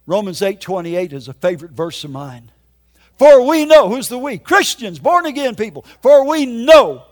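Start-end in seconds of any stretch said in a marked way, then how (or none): 3.2-3.9 loud, high declamation of a verse; raised, forceful pulpit voice; preaching scripture. Real, not acted. 6.0-7.0 loud, high, slow proclamation; slow pulpit emphasis at full voice; emphatic preaching. Real, not acted. Repeats verse with drawn-out emphasis.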